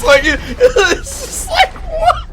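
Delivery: high, loud laughing shriek; real laughing outburst; laughing disbelief. Real, not acted. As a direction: crack up in disbelief.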